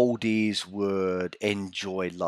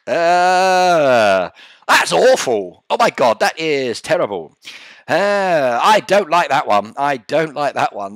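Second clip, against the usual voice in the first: much louder, higher horrified groans; loud disgust; 5 semitones higher, 11 dB louder than usual. Real, not acted. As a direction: recoil in loud real disgust. Reacting in disgust.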